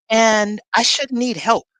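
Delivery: ordinary delivery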